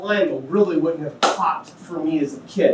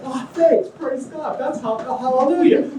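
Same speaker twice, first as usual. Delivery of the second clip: higher, hammed-up pious thanks; mock gushing gratitude; 8 semitones higher than usual. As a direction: fake holy delight, laid on thick. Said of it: "It's not like".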